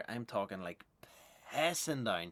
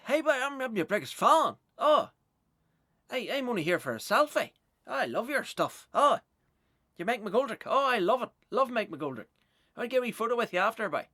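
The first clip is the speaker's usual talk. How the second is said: high, loud, fast gushing superfan; over-eager fan; 13 semitones higher, 11 dB louder than usual. Retold or imitated. Fan in Limavady mistaking him for someone else, loud gushing. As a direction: gush like a starstruck fan at the stage door. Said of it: "And it's just".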